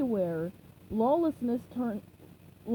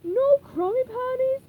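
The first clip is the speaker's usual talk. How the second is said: much higher, louder, slow wail; 14 semitones higher, 9 dB louder, much slower than usual. Acted. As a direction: wail it in despair. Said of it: "he wailed".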